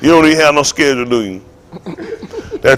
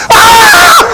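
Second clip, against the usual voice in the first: very high, loud mock scream; startled shriek; 6 dB louder than usual. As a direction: shriek as if scared by a toilet.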